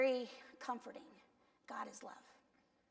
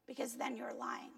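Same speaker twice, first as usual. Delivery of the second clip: whispered aside; 6 dB quieter than usual. Real, not acted. Preacher whispering a hard truth to the congregation, whispered aside. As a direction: drop to a whisper for the punchline.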